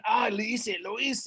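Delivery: high, elated exclaiming without much volume; excited. A narrator's reading. Hero running out with the bones, excited. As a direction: celebrate to yourself, pitch up high. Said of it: "He's so excited".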